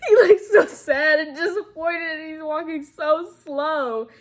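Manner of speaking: high, loud laughing through it; cracking up while describing. Real, not acted.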